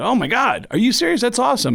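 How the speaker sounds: high-pitched retold delight; thrilled reaction. Comedian reacting to someone who works for Kiss, high thrilled voice. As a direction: gush in surprise, pitch leaping up. Retells delight at a fan's praise.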